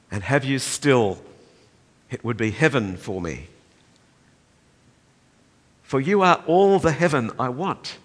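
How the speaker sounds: impassioned letter reading; fervent reading of a letter. Acted. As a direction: pour out the letter like a prayer. Reading prisoner's letter with fervor.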